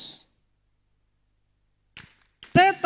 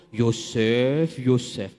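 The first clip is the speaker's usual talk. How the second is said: imagined voice of God; 7 semitones lower, much slower than usual. Pretend. Imagined God calling him.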